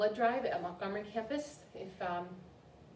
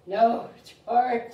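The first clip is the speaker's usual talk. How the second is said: very loud, higher, stretched scolding; exasperated mother; 4 semitones higher, 14 dB louder than usual. Acted. Mother scolding George after he oinks, loud exasperation. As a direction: cry out in mock exasperation.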